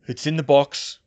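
loud, lightly voiced character reply; a hint of a character voice; Harry's voice. A narrator's reading. Harry answers, voiced by narrator.

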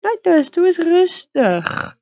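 high, slow pleading call; drawn-out high plea to a wild horse. Acted. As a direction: beg the horse to calm down.

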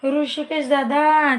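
stretched, slow call of a name; drawn-out call. Real, not acted. Host calling a viewer by name, slow drawn call.

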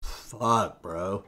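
soft, low fuck bro; stoner response. Real, not acted. Friend asked what to say about his mom, soft stoner reply. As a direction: let it slide out lazily like a stoner. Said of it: "most stoner response".

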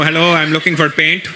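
put-on Indian accent. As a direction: ask politely in an Indian accent. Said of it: "when we walk into Home Depot and go".

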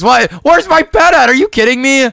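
can't believe it happened; outraged surprise. Real, not acted. Pet missing, outraged.

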